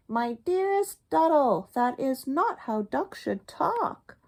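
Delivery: high, prim goose scolding; a hint of a honking goose. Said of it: "She honked".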